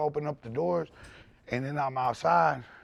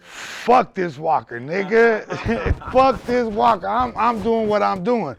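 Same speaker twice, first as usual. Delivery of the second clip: defiant declaration; 5 semitones higher, 7 dB louder than usual. Retold or imitated. Quoting his own resolve.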